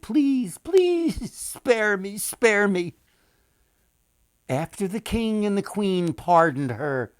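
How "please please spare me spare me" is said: high, loud begging for mercy; sobbing plea. A narrator's reading. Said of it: "sobbed and pleaded".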